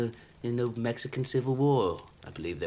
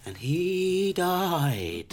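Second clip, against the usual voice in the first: very drawn-out, higher, theatrical doom; melodramatic reveal; 6 semitones higher, much slower than usual. Acted. Seller revealing little Johnny's death, melodramatic. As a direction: stretch each word for hammy effect.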